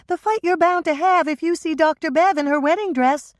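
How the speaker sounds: bright teasing younger sister; younger sister Michelle. A narrator's reading. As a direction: younger sister joking about a fight.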